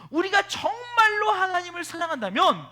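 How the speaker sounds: loud, high preaching cry; preacher pleading passionately with the congregation; impassioned cry. Real, not acted. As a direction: cry out with full conviction.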